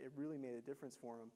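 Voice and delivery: ordinary talk, nothing marked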